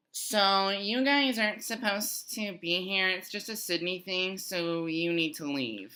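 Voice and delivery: high, slow, drawn-out girl impression; mock girl voice. Girl tells the group they need to leave, mock girl voice.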